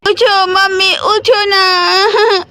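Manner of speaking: put-on sobbing little boy pleading with his mother; crying child. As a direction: cry and beg mummy to wake up, slowly. (रोते हुए बच्चे की आवाज़)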